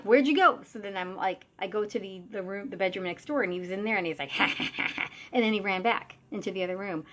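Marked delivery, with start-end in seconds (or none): 0.0-0.6 loud, high call after someone. Retold or imitated. Medium calling after the fleeing creature, loud and puzzled. 4.4-5.1 quick breathy mock cackle; imitated mocking laugh. Creature taunting the medium from next room, imitated laugh. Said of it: "laughing at me".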